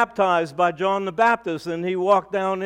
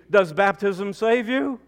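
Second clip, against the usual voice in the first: usual speaking voice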